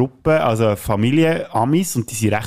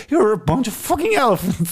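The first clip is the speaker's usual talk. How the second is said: put-on high Irish-accented jab; mock Irish accent, raised pitch; Irish accent quote; 11 semitones higher than usual. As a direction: fling the insult in an Irish accent. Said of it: "wo der sagt".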